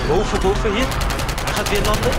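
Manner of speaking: loud, high urgent callout; excited shout. Real, not acted. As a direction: yell it to your squad. Teammate calling out in game.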